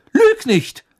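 high, loud scolding snap; scolding mother. A narrator's reading. Mother scolding George for lying, high and loud. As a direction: cut him off sharply.

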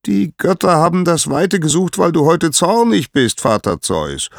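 very high, loud sky-herald report; high, ringing call; Helios calling down. A narrator's reading. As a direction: report it from high above, dropping on the address.

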